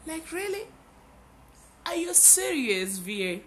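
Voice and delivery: high, loud disbelief; really, are you serious, shrill with disbelief; incredulous. Retold or imitated. Answering her own inner voice, high incredulous outburst. Reacting to scolding voice.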